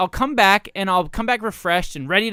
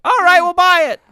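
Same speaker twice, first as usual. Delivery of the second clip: higher, louder skeptical executive; playing a studio exec; 11 semitones higher, 9 dB louder than usual. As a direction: grill the pitcher like a producer. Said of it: "I got a pitch".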